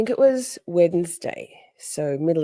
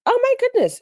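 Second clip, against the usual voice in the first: put-on high-pitched go at a Scottish accent; attempted Scottish accent; 4 dB louder than usual. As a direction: try an excited Scottish lilt, badly. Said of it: "I can't do Scottish accent".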